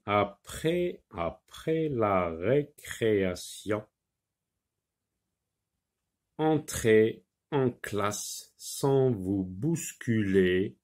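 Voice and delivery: slow, measured classroom reading with pauses between phrases; slow reading aloud. Shown as a demonstration. Teacher reads a model sentence to pupils, slow and deliberate. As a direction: read it out word by word, slowly. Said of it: "Alors on lit".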